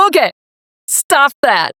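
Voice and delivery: firm interruption. Retold or imitated. Coach halting a client's morning podcast habit, firm interruption. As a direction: put a halt to it firmly.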